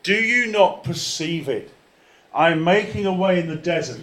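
very loud, ringing preacher's delivery; forceful scripture proclamation. Real, not acted. Preacher declaims God's promise to the church, forceful proclamation. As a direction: declare the verse at full force.

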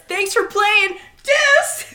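high, loud sing-song taunt; a hammed-up parting shot, high and loud; mock taunt. Retold taunt at Brooklyn.

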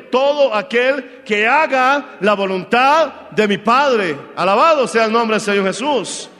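preacher pressing the congregation, loud and deliberate; full-force preaching. Acted. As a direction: hammer each phrase home.

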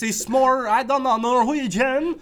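high, loud, slow fake Norwegian; drawn-out, hammed-up Norwegian-ish accent; vague Norwegian accent. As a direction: do a muddled Scandinavian accent. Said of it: "Norwegian or something of that place".

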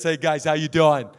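low, jovial mimicked greetings; father-in-law impression. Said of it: "And he talks like this".

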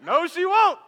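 high, loud exclaimed agreement; emphatic comeback. Retold or imitated. Dad answers little sister's remark, loud emphatic comeback. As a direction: fire it back, pitch jumping up.

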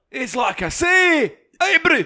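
mock Scots accent, slow and high; high, loud mock Scottish. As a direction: drag it out like a hammed Scotsman. Mocking Scottish brand name.

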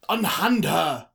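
booming, high, drawn-out command; loud theatrical demand. A narrator's reading. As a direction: order it like an outraged nobleman. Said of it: "Trevor demanded".

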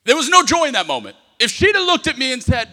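loud, impassioned sermon delivery; fiery preaching. Acted. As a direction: preach it hard to the crowd. Preaching with full force.

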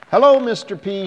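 put-on high, loud child greeting; little girl greeting. A narrator's reading. Girl greeting the man on the beach, high and loud. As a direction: chirp it out like an eager child. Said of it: "she said".

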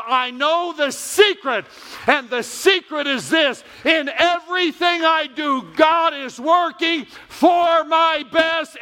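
shouted, high preaching climax; preaching at full force. Acted. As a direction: build the sermon to a soaring shout.

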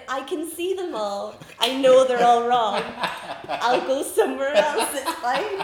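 loud, laughing 'they're all wrong'; laughing exasperation. Retold or imitated. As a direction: laugh through your frustration at the shop. Exasperated reply in shop, laughing.

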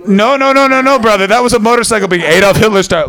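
loud, high laughing protest; laughing denial. Real, not acted. To the crowd, a loud high laughing denial of the fart. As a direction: protest too much, laughing.

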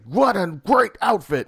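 high, loud, drawn-out exclamation; acted movie quote. Retelling the trailer to a friend, loud mimicked movie line. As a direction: call it out big and slow, mimicking the film. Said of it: "he's like".

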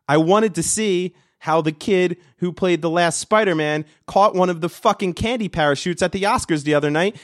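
usual speaking voice

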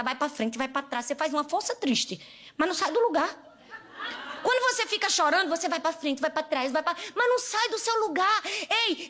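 ordinary talk

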